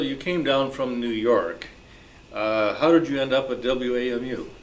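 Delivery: ordinary talk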